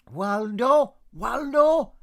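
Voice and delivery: high, loud call across the street; calling Waldo. A narrator's reading. Mother shouting for her missing son Waldo, loud and high. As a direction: shout for the boy to come home.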